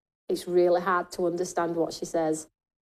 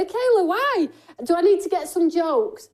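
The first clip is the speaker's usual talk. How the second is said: mock surprise; 8 semitones higher, 4 dB louder than usual. Real, not acted. Mock alarm at comment.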